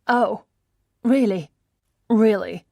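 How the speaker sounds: loud, mocking really really; sarcastic disbelief. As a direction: sneer the repeated really.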